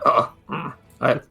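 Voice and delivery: high, delighted put-on voice; Gertrude savoring. Gertrude savours the noodles, high delighted character voice. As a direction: relish the food in her voice. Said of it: "I can imagine Gertrude's".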